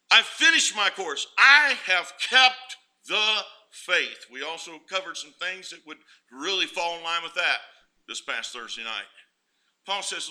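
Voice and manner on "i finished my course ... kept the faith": loud, very slow triumphant proclamation; proclaiming. Acted. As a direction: ring out every word, slowed right down. Paul's words proclaimed at peak.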